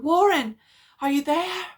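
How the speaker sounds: loud, high anxious call. A narrator's reading. Carter calls down into the receiver, anxious. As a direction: call down the line again. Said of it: "Again I called down".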